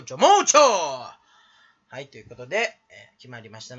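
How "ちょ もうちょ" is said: rhythm-gag punchline. Acted. Streamer caps a rhythm gag, comic yell. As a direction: belt out the punchline of the chant. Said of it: "リズムネタみたいな感じでね".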